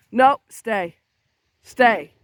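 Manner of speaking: loud, short order to a dog; firm dog command. Real, not acted. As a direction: order the dog to stay put. Ordering dog to stay put.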